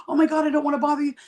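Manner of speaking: mock-startled, suddenly worried about bothering you; hammed-up alarmed concern; shocked gasp. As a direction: jump way up high on What, then rush the rest. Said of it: "they go".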